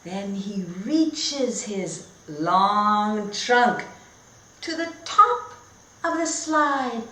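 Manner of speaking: lively, sing-song story reading; reading to children. Acted. Teacher reading a picture book to her class, bright and animated. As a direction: read it like a storybook for little kids.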